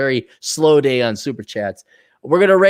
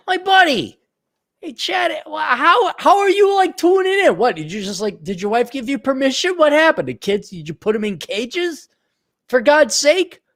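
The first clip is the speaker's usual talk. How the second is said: much higher, happy teasing; excited greeting; 10 semitones higher than usual. Real, not acted. Streamer excitedly teasing his old friend Chad. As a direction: greet him with delight.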